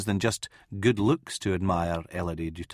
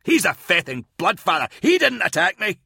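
rising, louder, higher accusation; angry accusation; 8 semitones higher, 4 dB louder than usual. Acted. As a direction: build from angry to shrill. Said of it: "Merrin pointed angrily".

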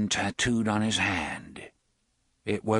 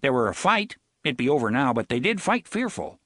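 mimicked Nordite accent; 3 semitones higher than usual. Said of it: "eerily good mimic".

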